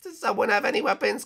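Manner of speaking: ordinary talk, nothing marked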